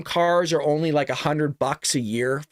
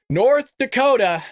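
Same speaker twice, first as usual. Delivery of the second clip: slow, high, louder call of North Dakota; hyped segment opener; announcing a topic; 8 semitones higher, 5 dB louder, slower than usual. Real, not acted. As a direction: draw out North Dakota like a headline.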